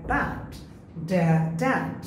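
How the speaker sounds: ordinary delivery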